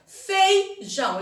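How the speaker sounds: "feijão" slowly as fei, jão; separated syllables. Shown as a demonstration. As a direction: say "feijão" in two slow beats. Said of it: "Separem as sílabas".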